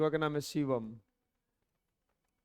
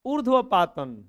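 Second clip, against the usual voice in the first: calling out; 5 semitones higher, 6 dB louder than usual. Real, not acted. Teacher calls out to class.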